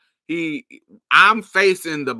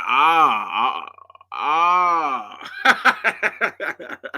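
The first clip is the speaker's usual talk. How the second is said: rising hammed-up ahs, climbing higher; mock aroused gasps; 3 semitones higher, 3 dB louder, much slower than usual. Imitating the older guy reacting to her touch, hammed-up gasps. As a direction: act out his startled pleasure.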